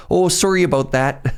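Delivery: high, hammed-up Canadian apology. Shown as a demonstration. Streamer joking with chat about saying sorry, exaggerated Canadian accent. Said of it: "the very Canadian is".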